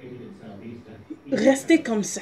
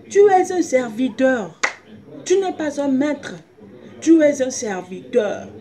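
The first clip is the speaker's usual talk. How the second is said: preaching; 3 semitones higher, 6 dB louder than usual. Acted. Preaching, slow emphatic declaration.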